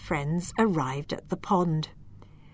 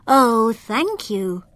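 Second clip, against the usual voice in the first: louder, slow, breathy little thanks; grateful fireflies; 3 semitones higher, 5 dB louder, slower than usual. Acted. Freed fireflies thanking Lila, slow and grateful. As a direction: thank her like tiny freed creatures. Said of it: "they said".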